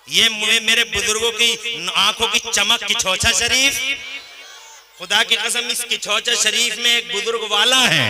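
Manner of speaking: loud, ringing sermon praise; full-force devotional oratory; fiery preaching. Acted. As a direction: preach it out to the crowd. Preaching to the crowd.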